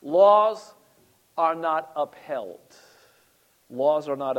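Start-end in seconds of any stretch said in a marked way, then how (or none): none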